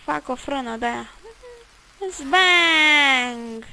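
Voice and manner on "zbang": crash noise. Pretend. Car crashes in driving game.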